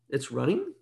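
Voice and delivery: mildly baffled, low soft question; puzzled newcomer. Acted. Newcomer to English questioning an idiom, puzzled. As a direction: ask it quietly, as if mystified. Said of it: "someone who comes to English".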